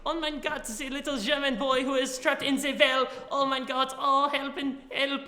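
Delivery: put-on high, hammy German kid voice; over-the-top German boy calling for help; bad German accent. Shown as a demonstration. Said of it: "real bad".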